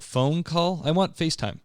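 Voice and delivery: a hint of a puzzled kid; young son. Son objecting to a phone call, lightly imitated. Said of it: "He's like".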